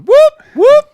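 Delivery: high, loud whooping call; police siren whoop. Shown as a demonstration. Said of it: "introduce you by going".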